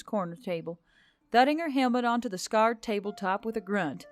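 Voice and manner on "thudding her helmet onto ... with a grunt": ordinary delivery, nothing marked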